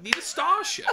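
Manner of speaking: laughing, voice up high. Retold or imitated. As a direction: squeak it out laughing. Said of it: "Rory goes".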